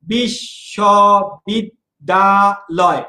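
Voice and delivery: বিশ্ববিদ্যালয় drawn out very slowly, each syllable split apart. Shown as a demonstration. Teacher splits a word into syllables for students, very slowly. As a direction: stretch বিশ্ববিদ্যালয় into separate beats. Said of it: "তাহলে আমাকে উচ্চারণ করতে হবে".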